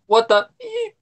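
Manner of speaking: high bleep-like squeal in the quote; censor squeal. Retold or imitated. Quoting the censored clip, sudden high squeal. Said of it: "it goes".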